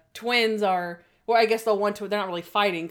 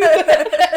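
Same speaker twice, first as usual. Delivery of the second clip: loud high laugh; 8 semitones higher, 12 dB louder than usual. Real, not acted. To a friend, laughing hard at the memory. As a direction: burst of real laughter.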